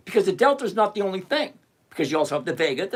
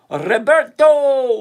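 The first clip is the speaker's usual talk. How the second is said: loud, high, long-stretched name; calling out; 7 semitones higher, 10 dB louder, much slower than usual. Real, not acted. Host welcoming Roberto to the chat, calling out loudly. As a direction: holler the name like a big welcome.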